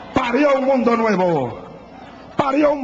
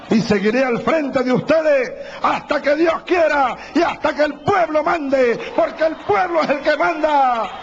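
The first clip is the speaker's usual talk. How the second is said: ranting dictator speech; 5 semitones higher than usual. Real, not acted. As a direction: roar the slogans to the crowd. Closing rally cries to crowd. (discurso exaltado a gritos)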